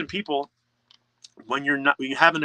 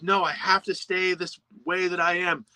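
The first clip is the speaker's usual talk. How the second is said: stubborn inner voice; 6 semitones higher than usual. Retold or imitated. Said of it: "You're like".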